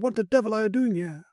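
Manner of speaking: high, indignant cry; cried out. A narrator's reading. Narrator catching Sinclair with a knife, high indignant cry. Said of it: "I cried".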